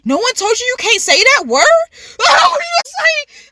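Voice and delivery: high-pitched, incredulous scolding; retold indignant disbelief. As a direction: scold the TV in dramatized outrage. Acted outrage at show contestant.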